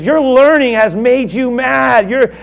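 high, loud, scornful outburst; mocking shout. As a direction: scoff at him at full volume. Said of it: "you can look at it".

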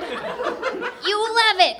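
high, drawn-out alien taunt; gloating high little alien; alien cry. A narrator's reading. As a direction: cry it slowly in a high creature voice. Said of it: "cried one".

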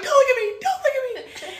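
high-pitched frantic squeal; retold scream. Begging the staring group to look away, acted scream. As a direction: squeal it in mock panic. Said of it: "I'm screaming".

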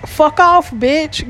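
high angry dismissal. Real, not acted. Cursing out a group commenter.

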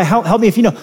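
ordinary delivery

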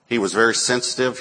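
ordinary talk

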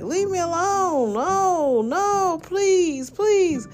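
put-on high, pleading elderly mother voice; pleading old mother. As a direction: beg to be left alone, way up high. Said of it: "And she's like".

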